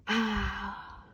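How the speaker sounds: ordinary delivery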